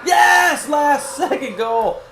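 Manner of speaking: real excited yell at a last-second goal; excited shout. Real, not acted. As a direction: yell it out, thrilled.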